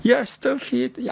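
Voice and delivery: high-pitched teasing put-down; mock joking jeer. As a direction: imitate friends poking fun, high and quick at first.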